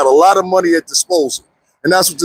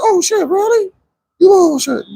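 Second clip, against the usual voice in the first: high-pitched mock astonishment; incredulous high exclamation, acted out; retold disbelief. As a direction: replay your amazed reaction, voice jumping high. Retells own reaction to legal news.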